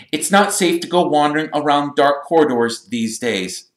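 high, loud lecture; stern. A narrator's reading. Percy warning the boys about dark corridors, stern. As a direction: warn them with officious authority. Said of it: "said Percy sternly".

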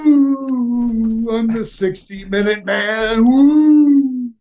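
high, whooping wrestler boast; Ric Flair. Acted. Wrestler mimics hard-partying colleague, high whooping brag. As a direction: crow it like a showman, long woos at both ends.

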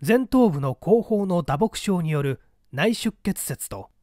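ordinary delivery

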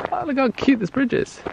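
sarcastic cooing. Real, not acted. Sarcastic cooing over a bridge.

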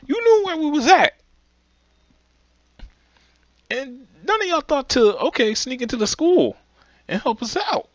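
high-pitched indignant scolding; outraged rant. Real, not acted. Ranting at the characters.

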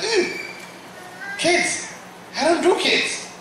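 put-on high, squeamish "ew, kids"; a high, disgusted whine. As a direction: recoil from the idea of kids.

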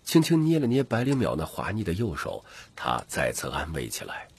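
ordinary delivery

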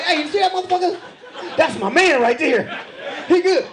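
high-pitched, excited fan boasting; cheering pit bull. Acted. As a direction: cheer on your man, high and eager. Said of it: "Pit Bulls like".

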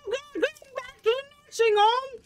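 put-on high defiant old lady shout; defiant grandma. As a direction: refuse like a stubborn granny. Granny refusing nursing home.

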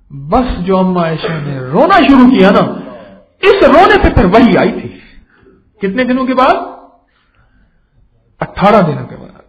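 loud, high, impassioned preaching; preaching at full force. Acted. Preacher telling the crowd how a father wept, preached at full force. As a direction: drive every line home to the crowd. Preacher stresses revelation after weeping.

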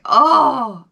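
loud startled oh; sudden realization. Retold or imitated. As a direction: jolt as it dawns on you. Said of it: "I was like".

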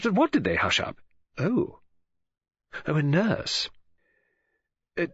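eager gossip, reacting to an unheard caller; gossipy lady novelist. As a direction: lively pitch swoops, curious and confiding. Telephone gossip, voiced as Missus Oliver.

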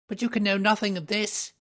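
high, loud, nervous denial; alarmed protest. A narrator's reading. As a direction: insist in a panic. Little man protests in alarm.

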